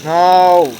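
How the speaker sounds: sharp loud high no of panic; panicked no. Real, not acted. Streamer panicking at the game's choice, loud. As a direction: blurt it out in alarm.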